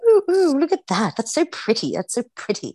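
high, gushing mock English accent; playful English accent. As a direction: parrot an English friend, delighted and sing-song. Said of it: "I'm not trying to do a good accent".

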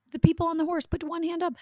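high-pitched instructor calling directions; riding instructor voice. Retold or imitated. Mom imitating girl instructing riders, high-pitched call.

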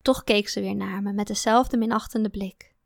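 plain reading voice

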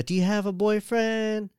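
put-on high Vietnamese-accented salon lady. Nail lady prying into a customer's love life, Vietnamese accent. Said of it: "she sounds like a Vietnamese lady".

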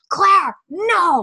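mock scream, very high and loud; high, hammed-up warning shriek; imagined TV-screaming viewer. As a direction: yell at the screen in playful horror. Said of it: "picture Anna being like".

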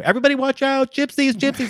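high, loud mock warning to the street; jokey high shout of alarm; mock alarm call. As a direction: call out the warning in a hammed-up panic. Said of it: "and I'm like".

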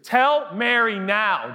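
high, slow insistent demand; demanding whine. Acted. Older sister orders Jesus to step in, whiny demand.

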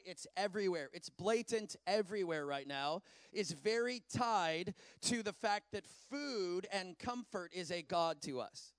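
ordinary talk, nothing marked

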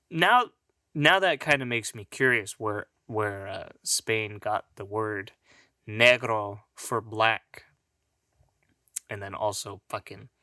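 usual speaking voice

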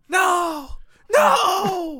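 high, loud panicked No; panicked shout. Pretend. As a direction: cry out in mock horror. Mock flashback panic.

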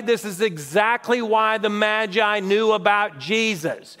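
ordinary talk

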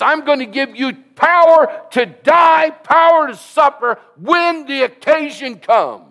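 full-force pulpit shout of a divine promise; preaching shout. Acted. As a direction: cry it out like a revival preacher. Preacher voicing Jesus' words.

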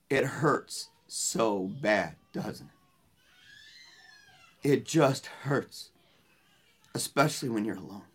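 retold consoling words, slow and soft; tender empathy. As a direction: gently share her hurt, with long pauses. Consoling a grieving mother, retold.

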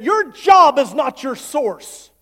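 slow, loud, emphatic mock yell; retold yell. Accountant yelling at the struggling pastor, slow loud mock yell. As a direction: hammer each word like the angry accountant. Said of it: "And he yelled at me".

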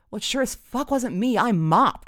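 high, loud comic outrage; indignant protest. Retold or imitated. Student director refuses the abandoned role, indignant. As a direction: protest it loudly and emphatically.

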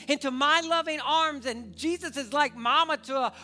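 loud, high impassioned sermon; preaching at full force. Acted. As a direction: preach it to the back row. Preaching God's plea.

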